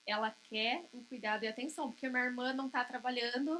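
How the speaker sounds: ordinary talk, nothing marked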